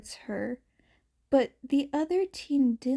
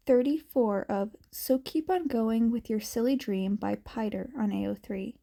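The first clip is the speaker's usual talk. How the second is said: ordinary delivery, nothing marked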